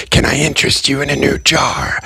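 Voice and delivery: gleeful. A narrator's reading. Gray offering the Festinger a new jar, gleeful. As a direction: sell him a jar with delight. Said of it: "Gray asked in a gleeful tone".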